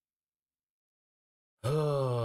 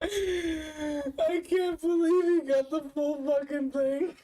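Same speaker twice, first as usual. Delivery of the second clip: laughing hard; 13 semitones higher, slower than usual. Real, not acted. Streamer laughing at captured clip.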